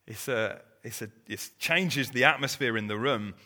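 ordinary delivery

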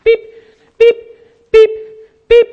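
short high till beep; imitated scanner beep. Dad zapping shopping through the till, high mimicked beeps. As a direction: mimic a checkout scanner, evenly spaced beeps.

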